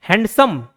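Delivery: "हैंडसम" parted as हैंड-सम; two separate syllables. Shown as a demonstration. Teacher giving a word example, "हैंडसम" in two syllables. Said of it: "दो बार structure change हो रहा है".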